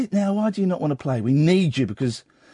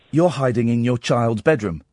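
ordinary talk, nothing marked

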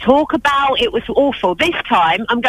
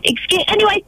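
usual speaking voice

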